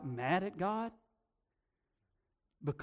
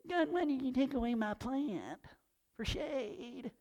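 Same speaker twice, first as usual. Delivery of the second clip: whiny Jonah; 6 semitones higher, 6 dB quieter than usual. Retold or imitated. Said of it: "he didn't whine anything".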